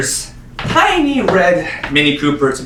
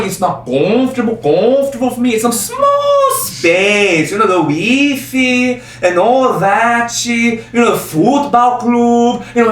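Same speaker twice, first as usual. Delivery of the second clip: put-on Brazilian accent; 8 semitones higher than usual. Actor imitating the claymation zoo puma, high Brazilian accent. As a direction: mimic a homesick Brazilian puma, stretching the words. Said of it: "made it very clear that he was Brazilian".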